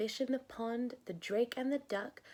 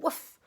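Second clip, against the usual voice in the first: a loud barking dog; hammed-up farmyard woof; 6 dB louder than usual. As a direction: bark like a dog. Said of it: "said the dog".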